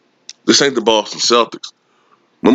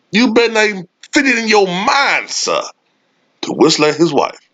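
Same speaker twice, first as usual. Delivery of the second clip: high, mocking warning voice; mocking stern warning; 11 semitones higher than usual. Scolding the listener on the boss's behalf, mock stern.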